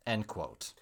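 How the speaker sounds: normal talking voice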